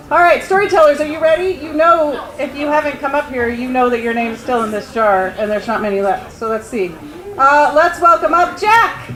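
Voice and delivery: high-pitched, projected emcee voice; event host announcing. Real, not acted. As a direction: call the next storyteller up to the stage. Host calls up next storyteller.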